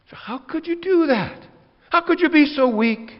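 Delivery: incredulous pastor rebuking; exasperated rebuke. Acted. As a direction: throw the disappointment at them. Scolding someone who relapsed.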